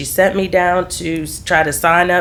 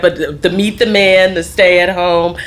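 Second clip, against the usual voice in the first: ordinary talk, nothing marked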